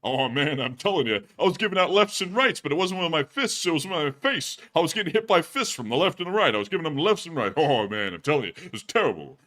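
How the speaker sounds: hammed-up hard-luck comedian; high, punchy setup falling into a weary tag; Rodney. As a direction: complain like a battered stand-up comic. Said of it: "with that Rodney voice".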